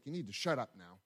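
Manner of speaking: low, controlled order to be quiet; stern retort. Retold or imitated. Angry man retorting to the coach, low stern retort. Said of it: "this is how he said to me".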